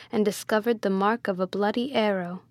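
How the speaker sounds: plain reading voice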